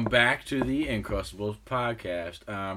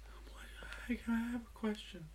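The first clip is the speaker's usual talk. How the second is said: whispering, high and eager; hushed eager kid voice; whispered question; 13 semitones higher, 11 dB quieter than usual. Retold or imitated. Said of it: "they're gonna be like".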